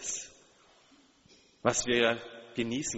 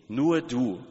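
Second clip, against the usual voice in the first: "Nur du" pressed out louder, both words weighted; stress on nur du; 5 dB louder than usual. Shown as a demonstration. Preacher to congregation, stressing "Nur du" with weight. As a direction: lean hard on "Nur du", each word landing. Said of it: "Darauf liegt die Betonung".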